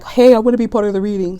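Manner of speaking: quick, lightly voiced little fly; playful fly voice. Flies on the windowsill wanting in on the reading, fast playful voice. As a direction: rattle it off fast as a nosy fly. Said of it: "they're like".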